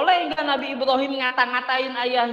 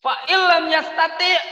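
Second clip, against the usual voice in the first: angry father; 6 semitones higher than usual. Acted. Father shouting his threat at Ibrahim. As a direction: hurl the threat at the son.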